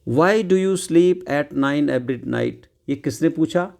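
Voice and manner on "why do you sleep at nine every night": reads each word out very slowly; very slow read-aloud. Shown as a demonstration. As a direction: dictate it word by word. Reading the mother's line slowly.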